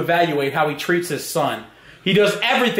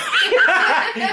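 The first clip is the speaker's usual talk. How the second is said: laughing; 10 semitones higher than usual. Real, not acted. Grandson laughs.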